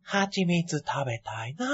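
soft, very slow character voice; low, dreamy drawl; Pooh impression. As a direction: quietly impersonate a honey-loving bear. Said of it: "プーの人だったでしょ、".

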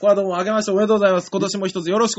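fast, rattled-off new year greeting; quick mock-polite reply; rapid formal greeting.

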